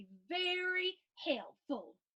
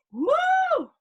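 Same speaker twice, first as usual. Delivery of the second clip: high, loud whoop; excited cheer; 10 dB louder than usual. Real, not acted. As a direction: cheer it out big. Cheering the listeners.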